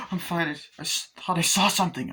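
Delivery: imitating flustered reassurance; mildly shaken reply. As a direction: answer as a rattled heroine. Shaken heroine answers, retold.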